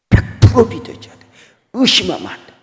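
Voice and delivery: high, strained preaching cry; high, hoarse insistence on full faith; emphatic preaching. Acted. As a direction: press it home with fervour.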